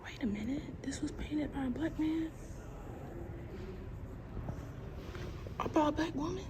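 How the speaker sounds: soft hushed gasp of surprise; quiet museum awe; hushed amazement. Real, not acted. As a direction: breathe it out in disbelief. Reading a museum plaque, amazed.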